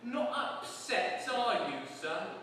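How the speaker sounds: hammed-up cockney warder; gloating warder. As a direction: crow it like a smug jailer. Warder needling Moist.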